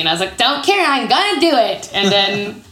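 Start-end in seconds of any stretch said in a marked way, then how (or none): 0.4-1.9 high, stubborn comeback; defiant. Retold or imitated. Newcomer answers someone saying it's not easy, defiant. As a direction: brush off the doubter, pitched up. Retelling her retort to a doubter.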